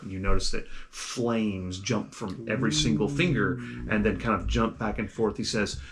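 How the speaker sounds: ordinary talk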